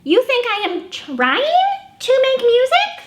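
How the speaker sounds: a bossy eager little pig, bursting; Piggy. Acted. Librarian voicing Piggy showing off a trumpet to Gerald.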